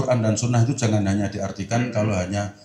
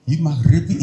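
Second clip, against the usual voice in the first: gleeful laughing hello; laughing greeting; 5 semitones higher, 5 dB louder than usual. Retold or imitated. Fasting man laughing as he greets maghrib. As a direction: greet the sunset call with a happy laugh.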